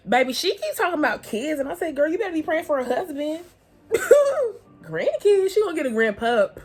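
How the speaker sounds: high giddy joke about the grandpup; laughing excitement. Real, not acted. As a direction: tease mom with a laugh. Joking about mom wanting grandkids.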